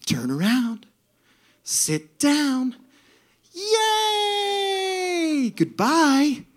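bright singsong with a big high "Yay!"; cheerful lifeguard. Retold or imitated. Comedian imitating the cheerful slide attendant to the audience, high joyful chirp. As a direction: chirp each step like the happiest woman alive. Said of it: "and it was joyful".

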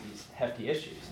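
ordinary talk

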